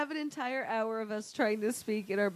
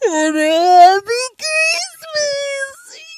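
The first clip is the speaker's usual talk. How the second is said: over the phone; 15 dB louder, much slower than usual. Shown as a demonstration. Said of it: "that phone call from Twelve Monkeys".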